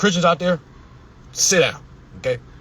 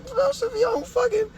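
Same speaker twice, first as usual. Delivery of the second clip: very high, squeaked disbelief; incredulous squeal. Retold or imitated. Reader talking back to Joseph in the story, incredulous squeak. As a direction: squeak it out, flabbergasted.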